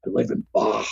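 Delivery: very low, gravelly-free deep rumble; Jesse Ventura impression. Playing Jesse Ventura for cohost, very low voice. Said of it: "going back to my Conan thing".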